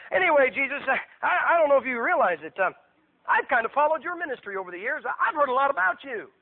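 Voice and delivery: acted starstruck chatter; eager fan Herod. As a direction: flatter a famous visitor, stumbling a little.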